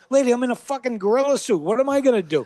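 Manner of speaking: fast, high, exasperated protest. Retold or imitated. The gorilla telling the hoop lady he can't help, fast and exasperated. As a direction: throw it back at her, fed up.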